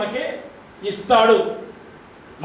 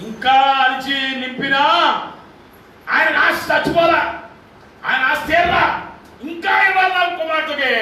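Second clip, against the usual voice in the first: fervent preaching; 5 semitones higher than usual. Real, not acted. Pastor voicing God's longing.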